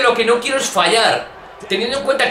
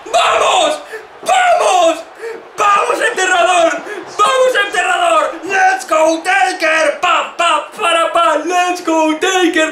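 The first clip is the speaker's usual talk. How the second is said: excited cheering; 7 semitones higher, slower than usual. Real, not acted. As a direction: scream with joy at the comeback. Cheers his wrestler after a kick-out.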